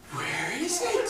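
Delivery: high, slow sing-song where is it; playful questioning to baby. Retold or imitated. Mother asking her baby daughter where the pacifier went. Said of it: "and I go".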